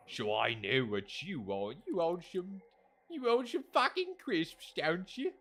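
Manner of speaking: British character voice rising high; put-on British accent. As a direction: play a pushy character in mock British. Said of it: "I'm trying to do a British accent".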